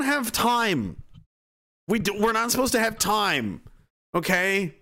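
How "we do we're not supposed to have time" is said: usual speaking voice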